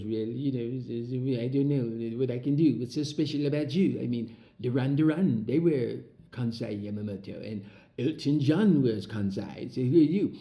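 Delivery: lightly voiced French shop owner, dismissive and unimpressed; a hint of a haughty Parisian brushing him off; snooty French shop owner. French shop owner brushing off an eager young dancer, lightly mocked haughty voice. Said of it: "he goes".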